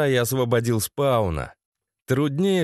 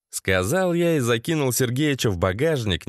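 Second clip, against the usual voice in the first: ordinary delivery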